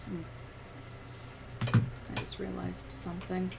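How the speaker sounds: ordinary talk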